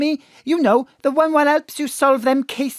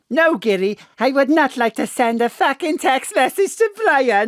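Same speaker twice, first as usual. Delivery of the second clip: laughing shout; 3 semitones higher than usual. Acted. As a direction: yell the refusal, half laughing. Ma'am answers the robot's question.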